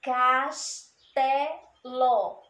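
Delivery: Castelo stretched very slowly, syllable by syllable; slow model word. Shown as a demonstration. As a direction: draw out Castelo slowly so children hear each part. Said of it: "O S está no meio".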